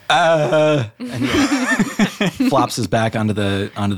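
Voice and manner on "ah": exhausted genie groan; a genie flopping down with a gasp. Acted. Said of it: "you just see him go".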